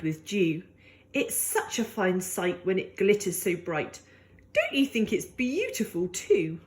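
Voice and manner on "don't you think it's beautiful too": ordinary delivery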